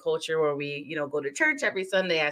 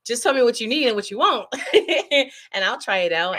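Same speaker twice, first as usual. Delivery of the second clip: high, loud eager boast; excited; 6 semitones higher, 5 dB louder than usual. Retold or imitated. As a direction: brag that you can do any voice. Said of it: "I'm like".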